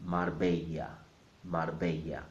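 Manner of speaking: Marbella as mar-BEH-ya; Spanish pronunciation. Shown as a demonstration. Teacher putting the parts together, Marbella as mar-BEH-ya. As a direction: say Marbella with r sounded and ll as y. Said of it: "So altogether is".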